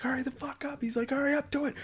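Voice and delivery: high, strained whispered yell; mock screaming. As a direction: imitate a screaming boss without full volume. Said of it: "with somebody screaming at me".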